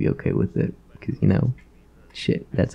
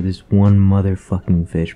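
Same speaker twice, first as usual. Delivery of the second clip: normal talking voice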